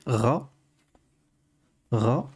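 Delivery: "Ra" said as Arabic ghayn plus short a; letter with fatha. Shown as a demonstration. Teacher shows learners the fatha vowel, demonstrated "Ra". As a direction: say "Ra" alone as a model syllable. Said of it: "je peux mettre une fatha et prononcer".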